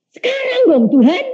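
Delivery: high, eager begging; a mock-childish plea for right now; pleading. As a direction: whine it up to God like an impatient child. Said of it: "Terus saya".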